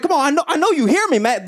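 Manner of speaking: upset stranger. Retold or imitated. Ignored man on the train getting upset, fast and high. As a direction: repeat it as he loses patience. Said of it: "then he starts getting upset".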